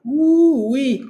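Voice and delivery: high, loud whoop of delight; a gleeful whistle of a cry; excited ooh-wee. Real, not acted. Streamer sees the chat fill with Canadians, a loud excited whoop.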